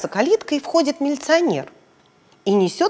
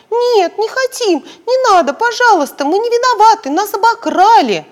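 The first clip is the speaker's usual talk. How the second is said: put-on much higher, louder, pleading child voice; frightened little girls; 11 semitones higher, 6 dB louder than usual. Frightened girls pleading with the policeman, high and scared. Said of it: "девочки ещё больше испугались".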